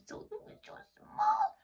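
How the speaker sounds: very high squeaky tiny voice. Pretend. Teacher acting tiny for children, very high squeak. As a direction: make yourself sound tiny.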